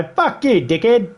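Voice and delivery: sudden high snap of a swear; a quick cheeky jab; mock blunt retort. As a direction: fling the insult off lightly. Retold blunt Aussie retort.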